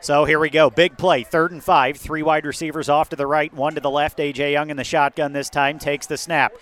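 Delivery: ordinary talk, nothing marked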